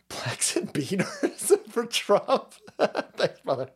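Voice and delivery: slow words broken up by laughter; cracking up mid-phrase; laughing. Real, not acted. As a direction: struggle to read through giggles. Cracks up reading a slogan.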